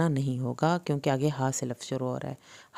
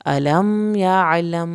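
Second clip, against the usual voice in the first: slow and louder intoned; slow recitation; 5 dB louder, much slower than usual. Shown as a demonstration. Teacher to students: slow Quran recitation. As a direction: recite it as verse. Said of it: "میم ساکن کے بعد با ہے".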